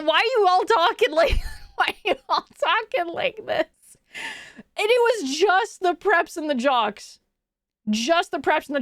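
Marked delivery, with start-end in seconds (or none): 0.0-3.7 high, incredulous mock protest; baffled question. Speaker recalling reaction to classmates, incredulous. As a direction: re-enact disbelief, pitched up.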